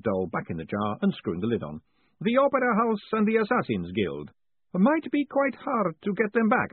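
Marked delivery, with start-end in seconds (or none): 2.2-6.7 put-on high, lively old professor; chatty academic explaining cheerfully; professor's voice. A narrator's reading. Professor chats to Moist, high and lively.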